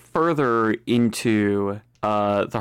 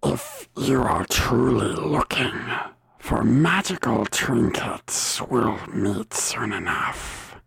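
slow, soft rasping whisper; menacing witch; 4 dB quieter, slower than usual. Acted. Witch invites seekers to follow, eerie slow whisper. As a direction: lure them on with drawn-out hoarse breath.

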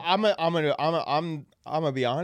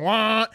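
higher-pitched mechanical "wah"; raised, drawn-out machine whine; imitated motor noise; 5 semitones higher, 3 dB louder than usual. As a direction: mimic a gadget extending. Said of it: "then it's going".

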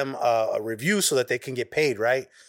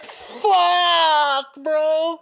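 much higher, louder wail of dismay; anguished cry; 13 semitones higher, 4 dB louder than usual. Retold or imitated. Retelling spotting the fake [name], high anguished cry. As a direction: let it rip like the bad news just hit.

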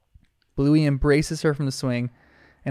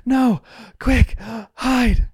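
put-on much higher, breathy little-girl voice; breathy cute little voice; 9 semitones higher, 4 dB louder, much slower than usual. Bingo urges her sister to hide, breathy cute little voice. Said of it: "in this breathy, cute little voice".

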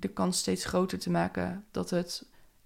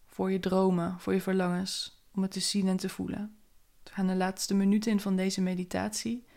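guided meditation. Acted. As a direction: guide the listener gently inward.

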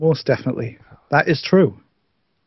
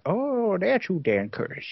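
softer, rising-then-falling mock realisation; imagined listener's realisation; 3 semitones higher, 9 dB quieter than usual. Guest imagines the lone cave listener tuning in, mock realisation. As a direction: voice a listener quietly working it out. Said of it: "and he'd be like".

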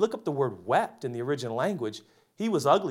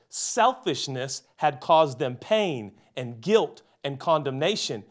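ordinary delivery

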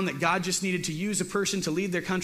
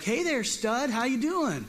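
much higher, falsely cheery teacher greeting; mock teacher; 7 semitones higher, slower than usual. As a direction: chirp it like a teacher mocking the kid. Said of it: "a teacher opens the door and is like".

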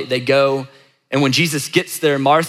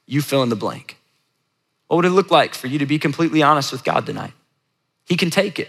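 ordinary talk

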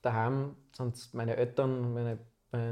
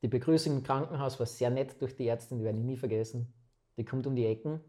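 ordinary talk, nothing marked

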